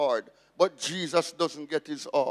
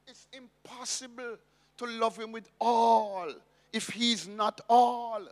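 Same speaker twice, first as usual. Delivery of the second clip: normal talking voice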